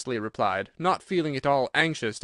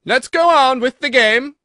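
a queen screaming a threat; furious shout; 12 semitones higher, 12 dB louder than usual. A narrator's reading. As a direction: roar the warning at the Duchess. Imperious Queen orders Alice.

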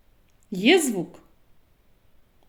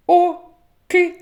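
ordinary talk, nothing marked

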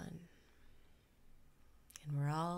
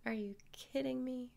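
incredulous aside; 7 semitones higher than usual. Acted. Breaks character mid-meditation.

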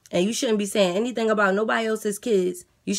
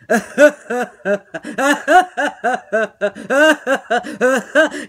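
burst of laughter; 3 semitones higher, 7 dB louder than usual. Real, not acted. Guard laughs at insult.

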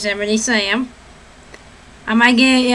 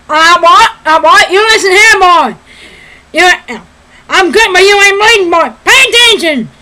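loud, blustering Southern cartoon rooster; Foghorn Leghorn; 5 semitones higher, 7 dB louder than usual. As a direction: lecture the boy at full cartoon volume. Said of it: "Foghorn Leghorn".

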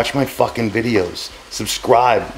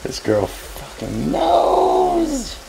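amazed praise; much slower than usual. Real, not acted. Proud of daughter's taste.

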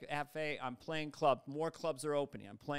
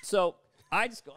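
usual speaking voice

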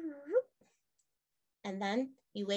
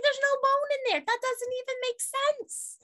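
put-on high, disbelieving kid voice, much higher; incredulous kid. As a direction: play a baffled student who can't believe it. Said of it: "I'm like".

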